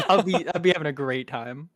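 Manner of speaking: ordinary talk, nothing marked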